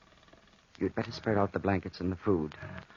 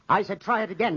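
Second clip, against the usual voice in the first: higher, pressing order; sharp insistent command; stern command; 9 semitones higher than usual. Acted. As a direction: force her to obey. Insisting she pet the panther.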